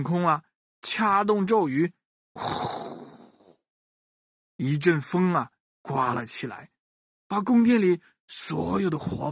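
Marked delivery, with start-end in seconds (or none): 2.3-3.6 soft, airy blowing sound; imitated wind whoosh. Storyteller imitates the conjured gust of wind, airy whoosh. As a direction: blow out a long, hushed gust.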